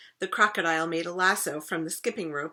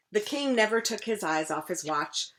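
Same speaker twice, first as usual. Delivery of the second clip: plain reading voice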